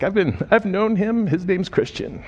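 retelling her words, near tears; teary. Retold or imitated. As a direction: voice the friend close to tears. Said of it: "kind of teary".

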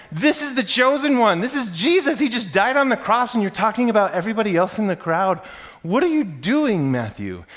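loud, high-pitched incredulous outburst; exasperated reader. Acted. Reader challenging Matthew over the crucifixion story, loud exasperation. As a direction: protest in disbelief, voice way up. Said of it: "you're the whole time going".

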